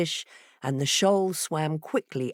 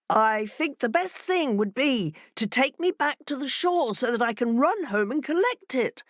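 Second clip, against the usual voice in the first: higher, reasonable-sounding fox; fox advising; 7 semitones higher than usual. A narrator's reading. Fox persuading the fishes, smooth. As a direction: sell the idea smoothly.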